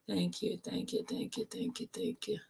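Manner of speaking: very soft, whispered repetition; whispered thanks. Real, not acted. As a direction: murmur thanks under the breath. Host thanking viewers under her breath.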